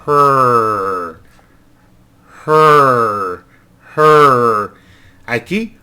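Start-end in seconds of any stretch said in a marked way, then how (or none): 0.1-4.7 "Her" with no vowel, just a loud r sound; "Her" said as a pure American r, louder; r-colored vowel. Shown as a demonstration. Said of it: "luego el sonido de la R".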